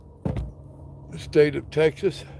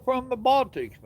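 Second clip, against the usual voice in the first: higher, delighted echo of the place; excited; 10 semitones higher than usual. Real, not acted. Trucker greeting a viewer from the Baltics, delighted. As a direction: echo it back high and pleased.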